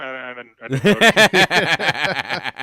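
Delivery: slow, loud, laughing through the words; laughing sarcasm. Real, not acted. Teasing a cohost about Tommy's accent, laughing sarcastically. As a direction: fake innocence while cracking up.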